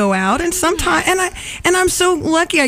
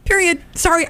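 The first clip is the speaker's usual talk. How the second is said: ordinary talk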